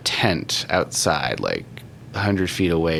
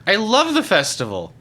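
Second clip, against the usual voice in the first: high character voice; high-pitched enthusiast; eager festival-goer; 11 semitones higher, 3 dB louder than usual. Acted. Game master voices Edgar.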